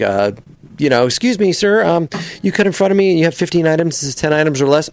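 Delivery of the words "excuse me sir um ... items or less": higher, courteous complaint; polite shopper without aviators; polite person. Shown as a demonstration. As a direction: point it out politely. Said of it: "a polite person like".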